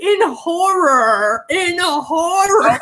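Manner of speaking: slow, melodramatic "horror", each time dragged out; drawn-out stress on HORROR. Retold or imitated. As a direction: lean long and dramatic on "horror". Dramatizing the kids' horrified reaction.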